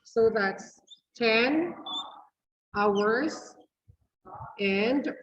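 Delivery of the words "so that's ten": ordinary talk, nothing marked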